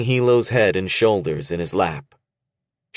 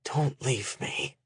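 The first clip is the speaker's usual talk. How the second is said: much softer, slow plea; begging softly; 5 semitones higher, 10 dB quieter, slower than usual. A narrator's reading. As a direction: beg him in a near-silent voice. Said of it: "he begged".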